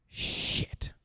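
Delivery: breathy whispered curse. Retold or imitated. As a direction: hush it out to yourself. Kid stops and swears to himself.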